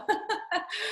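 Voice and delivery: quick breathy high laugh; a little embarrassed laugh escapes; short high laugh. Real, not acted. Nervous laugh after recalling callbacks.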